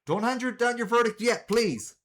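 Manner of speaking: high, loud courtroom interruption; urgent objection. Acted. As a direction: cry out to stop the verdict. Urgent courtroom objection before verdict.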